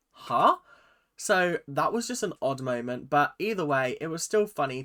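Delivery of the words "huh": baffled huh. Retold or imitated. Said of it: "Shegan's like".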